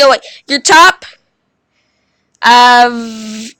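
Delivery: ordinary talk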